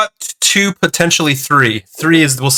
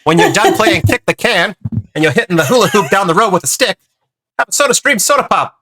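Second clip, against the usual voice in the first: put-on old-timey voice; 5 semitones higher than usual. Pitching soda to kids at play, old-timey voice. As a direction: build to the slogan like an old-time ad. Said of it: "he doesn't sound gangsterous".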